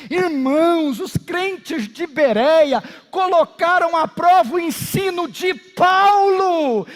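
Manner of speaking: preacher's rising exhortation; full-force preaching. Real, not acted. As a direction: thunder it out to the congregation, stretching key words.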